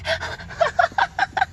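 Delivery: very high, loud rising laugh; shrieking laugh. Real, not acted. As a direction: let the laugh squeal up high. Laughing at own pun.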